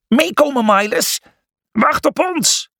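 high, urgent rallying call. A narrator's reading. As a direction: call the troops to follow. Calling companions to follow up the stairs. (hoog en luid roepen)

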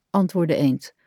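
plain reading voice

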